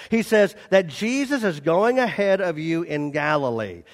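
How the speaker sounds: ordinary talk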